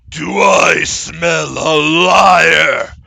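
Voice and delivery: slow, bellowing Minotaur; loud bullish voice. Acted. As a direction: bellow slowly like a bull monster. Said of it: "A loud, bullish voice c-, call out".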